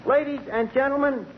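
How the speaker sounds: announcer intro. Acted. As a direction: present the star grandly into the mic. Introduces singer on microphone.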